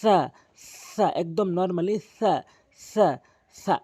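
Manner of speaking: সা said slowly with the tongue tip on the front teeth; tha demo. Shown as a demonstration. Teacher drilling সা slowly for learners. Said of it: "জিহ্বার আগাটি নিয়ে সামনে দুটি দাঁতের আগাতে লাগাবেন".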